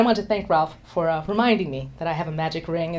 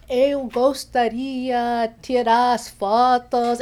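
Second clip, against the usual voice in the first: careful learner Portuguese; 5 semitones higher, much slower than usual. Retold or imitated. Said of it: "I was using my proper Portuguese".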